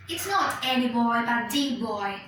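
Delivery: put-on the said as strong thee, not any boy but the boy; stress on THE. Shown as a demonstration. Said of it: "I'm making emphasis on".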